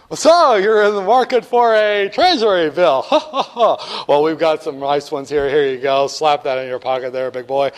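hammy, jovial salesman with a chuckle. Acted. Teacher as salesman working a student buyer, hammed up. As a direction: oily pitch to a customer, laughing. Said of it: "I am the salesman of them".

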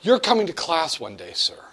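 ordinary delivery

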